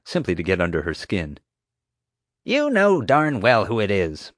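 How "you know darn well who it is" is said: snapping. A narrator's reading. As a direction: bite off each word at the boy. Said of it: "Marge snapped".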